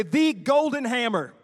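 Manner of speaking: leading the congregation in a slow, emphatic chant; proclaimed call-and-response. Shown as a demonstration. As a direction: draw out each word for the crowd to repeat.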